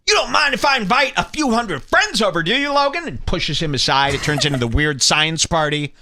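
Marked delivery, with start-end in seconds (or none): 0.1-3.1 lightly raised, mocking pushy guest voice; mock the rapper barging in with a high sneer; 6ix9ine imitation. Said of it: "And six nine just".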